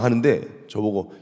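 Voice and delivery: normal talking voice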